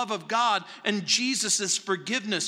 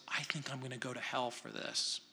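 a soft, hushed admission, voice dropped much softer; muttered under the breath, half to yourself; quiet confession; 7 semitones lower, 14 dB quieter, faster than usual. Retold or imitated. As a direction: murmur it like a guilty secret. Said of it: "say quietly".

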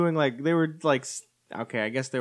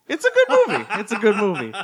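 much higher, laughing "It's a good movie"; laughing self-persuasion; 7 semitones higher, 7 dB louder than usual. Retold or imitated. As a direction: repeat it in disbelief, laughing. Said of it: "I was like".

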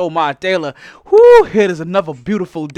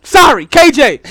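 ordinary delivery

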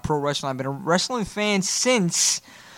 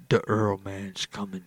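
put-on much lower, softer and slow, like a grim old gunslinger; gravelly Clint Eastwood growl; 10 semitones lower, 6 dB quieter, slower than usual. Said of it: "I said".

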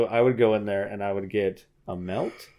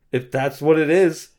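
exasperated yep; 6 semitones higher, 9 dB louder than usual. Retold or imitated. Resigned customer answer.

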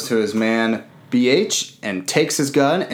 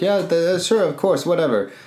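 higher, offhand go-ahead; mock officer; 7 semitones higher than usual. Officer lets Billy Zane aboard, higher and offhand. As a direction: toss off a careless yes.

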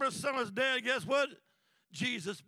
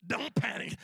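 usual speaking voice

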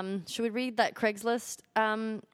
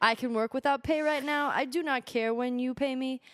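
put-on Korean accent. Co-host reading a job seeker's letter, mock Korean accent. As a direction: read it out in an attempted Korean accent. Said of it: "I can't do a Korean accent".

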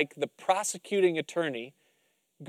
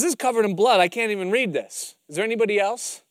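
raised judge; 5 semitones higher, 5 dB louder than usual. Acted. Judge voiced, puzzled.

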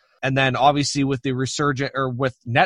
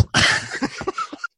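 high, breathy burst of laughter; 3 dB quieter than usual. Real, not acted. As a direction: let out a high, airy laugh. Laughs at the surprise news.

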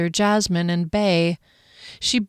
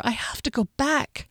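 higher, softer inward despair; despairing thought; 6 semitones higher, 5 dB quieter than usual. A narrator's reading. Woman giving up her search for water, softly despairing. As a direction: quietly give up hope, pitch lifted. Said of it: "she thought in despair".

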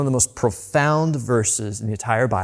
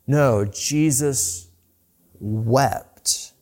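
slow emphatic; much slower than usual. Acted. Climax of contrast list.

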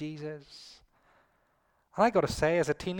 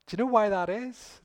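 ordinary delivery

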